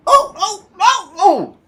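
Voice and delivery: very high, loud squeals; crying trucks. Acted. Trucks crying out when Edward stops. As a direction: squeal in silly alarm. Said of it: "they cried again".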